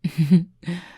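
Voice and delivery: short amused laugh; brief chuckle. Acted. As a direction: laugh it off lightly. Laughs at his own question.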